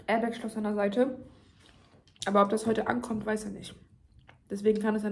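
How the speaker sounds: talking between bites; speaking while eating food; mouth full, chewing. Real, not acted. Seen 0: Eats throughout; fork in mouth at 6.7, cheeks full at 7.4.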